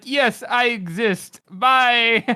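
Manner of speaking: high, drawn-out comic announcement; hammed-up high-pitched hello and goodbye; gleeful cameo. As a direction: pop in high and theatrical, then sign straight off. Mimicking a character's pointless cameo.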